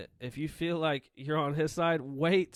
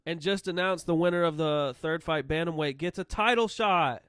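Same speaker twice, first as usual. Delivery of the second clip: raised, excited announcement; excited yelling; 3 semitones higher, 4 dB louder than usual. Real, not acted. Co-host excitedly announcing the title shot stakes, yelling. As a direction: get carried away and yell it. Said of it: "I'm sorry, I'm yelling".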